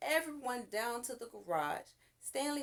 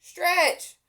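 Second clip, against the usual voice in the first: higher, much louder cry; cried out; 8 semitones higher, 13 dB louder than usual. A narrator's reading. Boy crying to stretch him out, urgent. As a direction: shout the order. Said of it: "cried Stanley".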